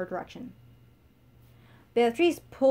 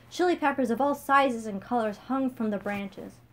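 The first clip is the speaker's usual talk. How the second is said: plain reading voice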